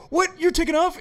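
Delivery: high, disbelieving question; surprised. Retold or imitated. Asking Rich in disbelief if he's leaving, surprised. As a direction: sound stunned he's leaving. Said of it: "And I go".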